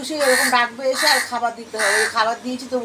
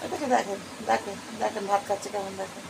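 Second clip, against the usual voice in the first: softly, so as not to disturb the eating pet; hushed aside; 4 semitones lower, 16 dB quieter than usual. Real, not acted. Telling viewers quietly how the pet eats, hushed. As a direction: drop your voice to show the viewers.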